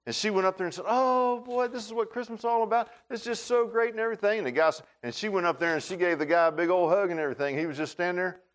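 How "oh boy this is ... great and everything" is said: high-pitched imitation of an overjoyed Christmas shopper; gushing excitement. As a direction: gush with mock holiday delight, voice lifted high. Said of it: "she was all excited about it".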